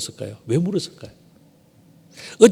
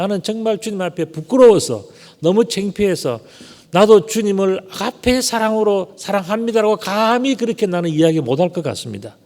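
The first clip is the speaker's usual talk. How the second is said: ashamed Peter; 6 semitones higher than usual. Acted.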